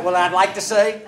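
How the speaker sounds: loud, high, mock-heated; hammed-up outrage; mock indignant. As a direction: sound worked up while drafting the angry email. Preacher acting angry email drafting.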